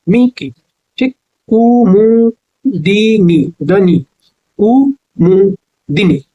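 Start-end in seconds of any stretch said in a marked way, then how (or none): 1.5-4.1 very slow, Kumudini drawn out as ku-mu-di-nee; slow dictation. Shown as a demonstration. Teacher dictating Kumudini slowly. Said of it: "कुमुदिनी होता है". 4.6-6.2 very slow, Kumudini drawn out as ku-mu-di-nee; slow dictation. Shown as a demonstration. Teacher dictating Kumudini slowly. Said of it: "कुमुदिनी होता है".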